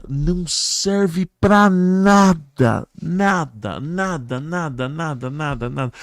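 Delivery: NADA stretched long and high; emphatic dismissal. Real, not acted. Guest dismissing theological debates, emphatically drawn-out NADA.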